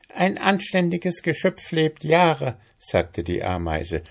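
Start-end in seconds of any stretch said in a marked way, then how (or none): 0.1-2.6 high, officious ant voice; businesslike insect, pitched up. A narrator's reading. Ant questioning the mayfly, officious.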